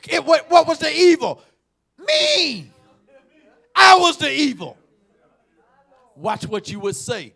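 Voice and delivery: loud, high preacher's cry; preacher confronting the congregation; full-force preaching. Acted. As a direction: call it out with fire.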